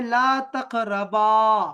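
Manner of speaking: teacher recites the verse slowly; slow loud recitation. Shown as a demonstration. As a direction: stretch ला तक़रबा slowly for the class. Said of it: "Quran मजीद में है".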